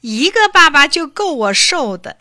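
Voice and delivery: high, loud whimpering complaint; a wretched wail; whining despair. A narrator's reading. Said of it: "他哭丧着脸说". (哭丧着脸抱怨)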